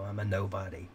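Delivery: ordinary talk, nothing marked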